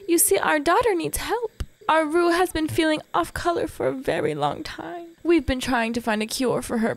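lilting high voice of a gracious wife; mayor's wife. Acted. Mayor's wife asks for help for her daughter, high and polite.

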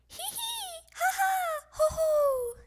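very high squealing giggles; tickled laughter. Acted. Froggy tickled with a feather duster, high squealing laughter. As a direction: laugh like you're being tickled.

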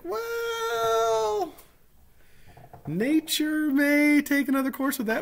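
very high put-on voice, drawn out; comic high voice. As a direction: draw it out in a mock high pitch. Comic put-on reply about his eyebrows.

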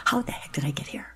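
hushed whisper to herself; whispered thought. Retold or imitated. Alone in a jail cell, asking herself in a whisper. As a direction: breathe it out as a private thought.

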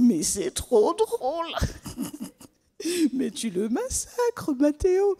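high, slow, giggling through the words; amused girl laughing at his English. Acted. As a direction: laugh while scolding him. Elodie laughing at mispronunciation.

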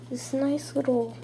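usual speaking voice

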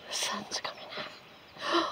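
slow, hushed breathy whisper. Real, not acted. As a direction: whisper it slowly, like a secret. Announces sun, then whispers it.

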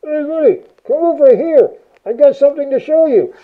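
high, loud call to a buddy; mock underwater call through a mouthpiece. Shown as a demonstration. Demoing talking through Sea Voice.

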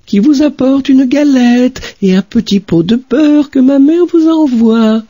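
very high put-on little girl voice; wolf mimicking the child sweetly; faked girl's voice. Wolf at grandmother's door faking the girl's voice, very high. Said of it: "en contrefaisant sa voix".

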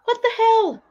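high-pitched comic outrage; exasperated, squeaky indignation; furious. Real, not acted. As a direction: wail it like a cheated customer. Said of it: "I'm furious".

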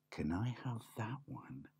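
whispered timid ask. Acted. Shy piglet asks the shopkeeper, whispered.

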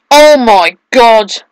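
drawn-out loud gasp of shock; shocked exclaim. Real, not acted. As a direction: stretch it out in disbelief. Gameplay shock, slow loud.

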